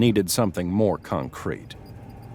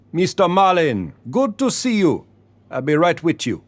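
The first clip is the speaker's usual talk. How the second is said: put-on much higher Caribbean-accented greeting; 8 semitones higher than usual. A narrator's reading. Leroy greeting Marlon in front of customers in Caribbean accent. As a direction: keep up the Caribbean act for Marlon. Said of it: "He spoke in a deep Caribbean accent".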